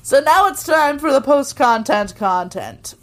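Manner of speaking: not normal voice. Real, not acted. Host introducing the next podcast segment in an odd voice. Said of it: "I didn't say that in the, my normal voice".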